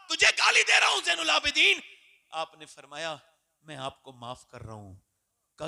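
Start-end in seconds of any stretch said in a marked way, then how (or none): 0.1-1.8 insolent taunt. Acted. Insulting man grabs the Imam's collar. 3.6-4.9 gentle forgiveness. Acted. Imam gently forgives his insulter.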